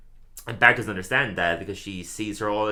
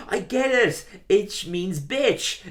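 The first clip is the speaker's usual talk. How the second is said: put-on much higher, slowed kid voice with a smug reveal; goofy kid voice; 10 semitones higher, much slower than usual. Milhouse spelling out the billboard joke, high goofy kid voice. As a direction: play the dim kid proudly cracking the joke.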